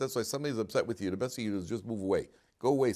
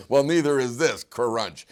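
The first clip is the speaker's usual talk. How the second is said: mocking retort; 3 semitones higher, 9 dB louder, slower than usual. Family mocks peacemaker.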